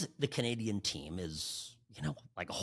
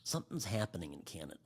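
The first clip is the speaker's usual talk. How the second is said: normal talking voice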